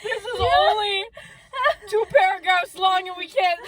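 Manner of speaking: high-pitched, laughter-strained complaint; helpless laughter. Real, not acted. Readers unable to finish two paragraphs, helpless laughter. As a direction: protest through uncontrollable giggles. Too amused to keep reading.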